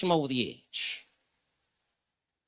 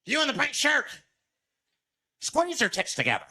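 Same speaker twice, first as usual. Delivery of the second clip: creepy demand; 8 semitones higher than usual. Retold or imitated. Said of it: "he starts making demands".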